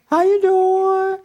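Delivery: very high, slow falsetto customer greeting; hammed-up high falsetto cashier greeting. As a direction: put on a high, sugary shop-clerk voice.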